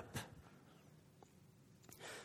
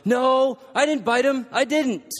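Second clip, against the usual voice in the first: put-on higher, louder child's protest; yelling denial; 9 semitones higher, 5 dB louder than usual. Accused child chasing after, yelling denial. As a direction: shout back defensively like the accused kid.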